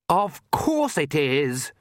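slow, high, drawn-out whine; moaned. A narrator's reading. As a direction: moan it out wearily. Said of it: "moaned Lacrimosa".

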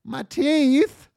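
high, loud, startled mock voice; squeaky mock kid. Friends squeal in shock at the teeth lady. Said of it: "And they go".

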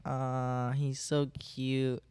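drawn-out mock aww; imitated gushing listener, stretched out; cooing. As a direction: coo slowly as if over someone adorable. Imitates listeners cooing over him.

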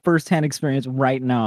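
ordinary talk

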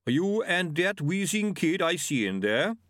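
slow, measured creature voice; slow Yeti voice. Acted. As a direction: speak slowly like a gentle giant. Yeti asks.

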